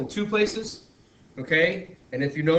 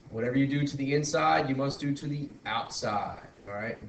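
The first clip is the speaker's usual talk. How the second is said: usual speaking voice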